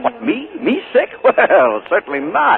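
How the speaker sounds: high, loud laugh brushing it aside. Acted. Uncle denying he is sick to his niece, laughing. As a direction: laugh away the idea of being ill.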